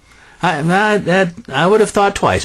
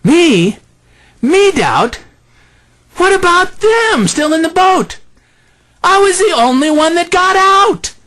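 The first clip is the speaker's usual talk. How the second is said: much higher, squeaky protest; indignant Peter; 4 dB louder than usual. Pretend. Peter protesting to Jesus, squeaky and much higher. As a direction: whine in wounded pride. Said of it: "I would be thinking".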